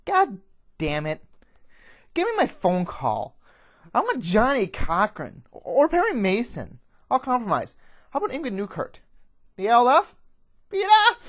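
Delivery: high, loud frantic bargaining; outraged yelling, getting wilder; shouted protests. Acted. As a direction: holler demands while being dragged off. Fox dragged away, shouting demands.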